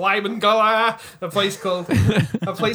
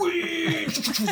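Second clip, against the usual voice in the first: mock death cry; 8 semitones higher, 3 dB quieter than usual. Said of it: "he's like".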